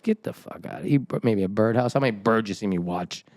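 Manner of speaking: low, dismissive grumble; hushed start, then low grumpy scorn; unimpressed dad. Acted. Acting out unimpressed dad receiving gift.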